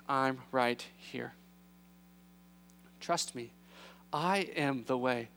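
low, slow and unhurried; calm reassurance. Acted. Jesus answering his anxious disciples, calm reassurance.

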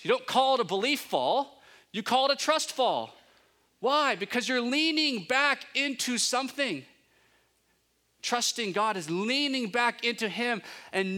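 high, loud preacher's build; rising, loud sermon emphasis; preaching at full force. Real, not acted. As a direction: proclaim it to the congregation. Preaching climax.

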